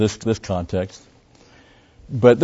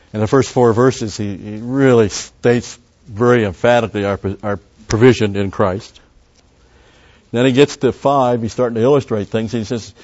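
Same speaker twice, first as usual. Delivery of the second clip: normal talking voice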